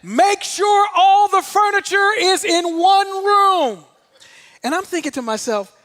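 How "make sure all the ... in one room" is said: drawn-out, high-pitched command yell; yelled order to a room of trainees; instructor's yell. Retold or imitated. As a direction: bellow it slowly like a jump school instructor.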